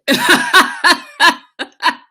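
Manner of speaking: loud burst of helpless laughter; high cackling laugh. Real, not acted. High laugh at learning about olives. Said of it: "That's why I'm laughing".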